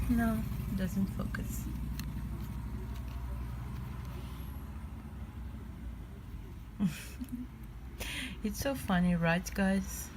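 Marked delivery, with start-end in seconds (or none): 1.7-8.4 laughing. Real, not acted. Laughs at failed focus.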